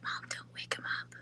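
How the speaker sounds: whispered quote of a worried daughter; hushed whisper, don't wake the kittens; whispering over a crib. Retold or imitated. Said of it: "Kenzie's like".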